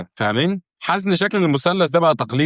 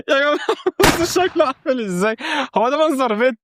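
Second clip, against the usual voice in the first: outraged disbelief; 12 semitones higher than usual. Real, not acted. Mock outrage at own house.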